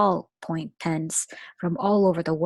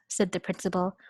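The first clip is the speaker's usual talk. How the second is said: ordinary delivery, nothing marked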